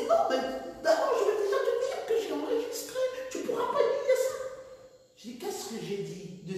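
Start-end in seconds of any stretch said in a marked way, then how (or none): 0.1-4.3 very high laughing mock voice; small voice. Pastor claiming he recorded the sermon, very high mocking voice. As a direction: squeak it out like the indignant pastor, laughing. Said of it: "Il avait une petite voix".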